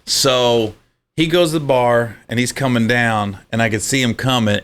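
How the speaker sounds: ordinary talk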